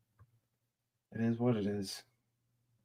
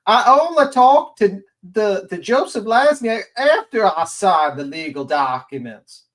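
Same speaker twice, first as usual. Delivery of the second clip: mocking quote; 7 semitones higher, 7 dB louder than usual. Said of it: "Brittany J last night stating".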